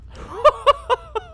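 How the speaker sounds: high, squealing laugh; helpless high-pitched laughing fit; burst of laughter. Real, not acted. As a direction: crack up laughing. Laughing at the game.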